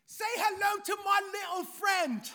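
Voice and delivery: high, loud mock British Scarface line; a hammed-up British accent, shouted. Shown as a demonstration. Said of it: "with a British accent".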